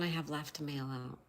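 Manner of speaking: whisper. Real, not acted. Aside about the last package.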